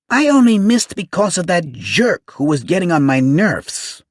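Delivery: high, loud shrill whine; shrill complaining. A narrator's reading. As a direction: whine it out, lisping and annoyed. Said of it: "he complained".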